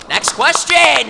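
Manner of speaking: high, loud, drawn-out shout; calling out. Real, not acted. Panelist calling up the next fan, loud slow shout. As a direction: holler it slowly to the room.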